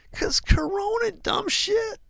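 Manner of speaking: very high, taunting retort; mocking trader. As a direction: sneer it in a squeaky voice. Trader mocks friend, very high.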